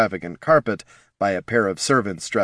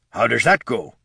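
lightly voiced old man asking; a hint of a fussy elder; old Duero voice. Mortis asking Deathbloom, lightly voiced old man.

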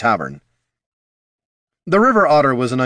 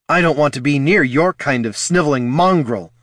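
louder, higher indignant sputter; 6 semitones higher, 4 dB louder than usual. A narrator's reading. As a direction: spit the insult out in outrage. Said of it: "Loruna sputtered".

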